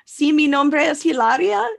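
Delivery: lightly hammed-up Spanish accent; mock Spanish accent. Mocking her introducing herself as Hilaria, put-on Spanish accent. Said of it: "she fakes an accent around him".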